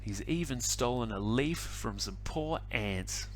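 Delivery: plain reading voice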